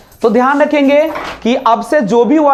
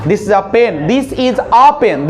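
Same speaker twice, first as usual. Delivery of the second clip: slow model sentence; 2 semitones lower, much slower than usual. Shown as a demonstration. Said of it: "तो कैसे बोलेगा?".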